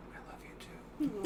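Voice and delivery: soft, voiceless whisper. Acted. Player whispers to the freed chocobo, soft whisper. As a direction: whisper it tenderly to an animal. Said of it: "I whisper to it".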